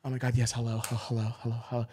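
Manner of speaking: hushed, gentle greeting in imitation; soft, welcoming. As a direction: greet them very softly, as if welcoming someone in. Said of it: "like that, that soft and, and rel-, and welcoming".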